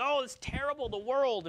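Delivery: high-pitched, hammed-up lament; mock alarm. Acts out a worried objector.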